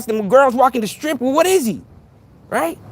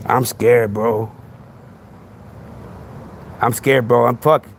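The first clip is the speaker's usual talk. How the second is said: much lower, mock-terrified mumble; mock scared; 12 semitones lower, 4 dB quieter, slower than usual. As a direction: pretend to be scared stiff, deep and hushed. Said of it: "I'm scared now".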